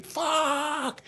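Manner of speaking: very high, stretched-out curse; mock yell. Father whacked on the back, mock yell. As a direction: imitate a long pained howl. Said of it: "he'll yell".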